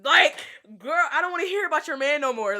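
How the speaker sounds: loud, high, fast exasperated rant; fed up. Retold or imitated. Telling her friend to stop talking about her man. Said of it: "I was just like".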